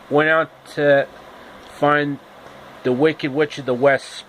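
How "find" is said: plain reading voice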